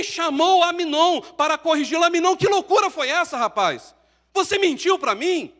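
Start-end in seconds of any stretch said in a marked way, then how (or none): 2.0-5.5 father's rebuke. Acted. Imagined David rebuking Aminon.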